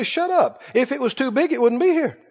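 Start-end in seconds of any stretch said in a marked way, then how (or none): 0.0-0.6 mock rebuke.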